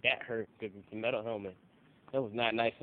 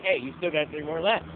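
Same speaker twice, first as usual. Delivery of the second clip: louder, raised retold yell; mock coach yell; 5 semitones higher, 7 dB louder than usual. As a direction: bark it like the coach nagging. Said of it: "He's like".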